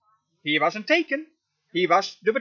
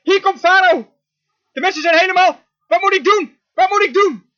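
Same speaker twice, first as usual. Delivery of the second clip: loud, high cry of alarm; Mozes crying out; 8 semitones higher, 7 dB louder than usual. Acted. Moses sees Pharaoh coming and cries to God, crying out. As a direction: call up to God in mounting panic. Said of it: "Begin te roepen".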